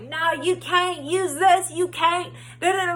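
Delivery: mocking rude clerk. Mom mimicking the laundromat lady, mocking and bossy. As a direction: sneer it like the snippy worker who refused her.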